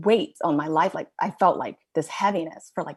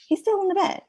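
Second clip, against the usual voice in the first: much higher, exasperated disbelief; 13 semitones higher, faster than usual. Retold or imitated. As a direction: replay the shock of finding him still in bed. Mom finds son still in bed.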